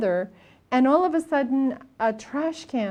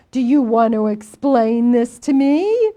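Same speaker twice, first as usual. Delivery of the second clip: loud, challenging question; loud and worked up, pressing for an answer; indignant demand; 7 dB louder than usual. Retold or imitated. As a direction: demand an explanation, voice raised. Agitated student demands explanation from teacher.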